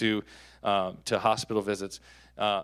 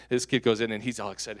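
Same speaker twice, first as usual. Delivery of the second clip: ordinary talk, nothing marked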